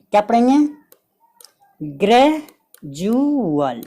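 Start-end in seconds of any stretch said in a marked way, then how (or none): 1.8-3.8 gradual stretched very slowly, syllable by syllable, gre-ju-al; drawn-out model word. Shown as a demonstration. Teacher slowly modelling the word for students to copy, drawn out. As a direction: sound out gradual slowly so they can copy it. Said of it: "इस तरह का पढ़ेंगे".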